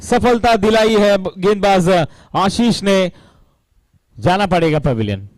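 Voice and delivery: high, loud, building wicket call; rising thrill as the catch is taken; excited commentary. Real, not acted.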